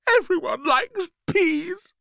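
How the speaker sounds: slow, very high, wounded squeak; crying pea. A narrator's reading. Hurt pea protests being rejected, tearful. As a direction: whine it tearfully in a tiny voice. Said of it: "The peas seemed to be crying".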